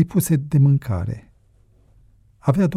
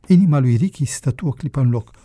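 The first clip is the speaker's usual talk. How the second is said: ordinary delivery, nothing marked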